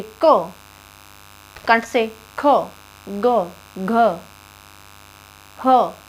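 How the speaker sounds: को, ख, ग, घ, ह pressed out from the throat; throat sounds demo. Shown as a demonstration. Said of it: "आप बोल के देखिए". (कंठ से दबाकर वर्णों का उच्चारण)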